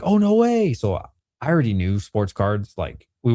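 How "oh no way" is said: high, loud burst of disbelief; amazed reaction. Retold or imitated. Dad recalling his kids' God packs, surprised. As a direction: retell your own stunned reaction, pitched way up. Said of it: "And so I was like".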